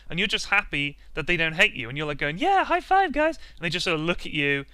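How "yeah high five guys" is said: much higher squeaky mock enthusiasm; high hopeful fake cheer; meek cheer. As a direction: chirp it out like an eager outsider. Awkward player cheering with the team.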